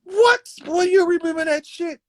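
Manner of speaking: high falsetto, loud disbelief; astonished father. Retold or imitated. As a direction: play an amazed dad squealing in mock shock. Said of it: "Pop, you remember when you told me".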